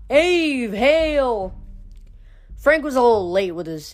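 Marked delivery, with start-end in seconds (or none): 0.1-1.5 high ringing cheer; crowd shout. A narrator's reading. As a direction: shout it like a legion. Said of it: "The campers gave a shout".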